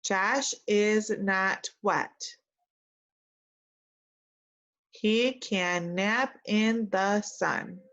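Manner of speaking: very slow, word by word; very slow model reading. Shown as a demonstration. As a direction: give each word its own beat. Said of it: "repeat after me".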